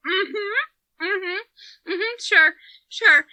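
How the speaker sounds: high, strained mm-hmms fighting tears; holding back tears. Retold or imitated. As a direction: choke out the agreement, close to crying. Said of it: "I'm already fighting tears".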